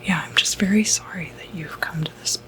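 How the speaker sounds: normal talking voice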